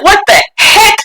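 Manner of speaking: high playful exclamation; imitating a kid's forbidden exclamation; mock shock. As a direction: kid blurting it out. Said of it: "And you'd be like".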